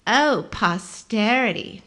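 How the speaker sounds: slow mocking repeat; mock the word, drawn out; sarcastic. A narrator's reading. Nadine mocking slowly.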